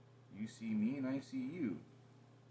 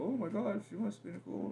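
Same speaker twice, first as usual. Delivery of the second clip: high, gushing mock of her words; mocking partner; 11 semitones higher, 6 dB louder than usual. Mocking partner's gush to her, high and loud. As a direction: throw her gush back at her, pitched way up. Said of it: "when you go".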